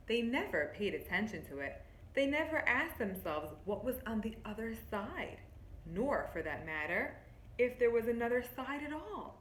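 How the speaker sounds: lightly voiced story narration, drawing them in; reading to children. Teacher reading a picture book to her class, storybook voice.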